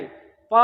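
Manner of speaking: प breathed out with no voice, lips barely meeting; voiceless, breathy प without lip contact; whispered demo. Shown as a demonstration. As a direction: show प failing to sound, only breath. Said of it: "बिना छुए तो आवाज ही नहीं आएगी".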